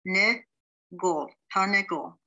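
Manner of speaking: Ne, gol said apart, then tarnegol joined; syllables then whole word. Shown as a demonstration. Teacher sounding out syllables, then blending the word. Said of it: "The second word".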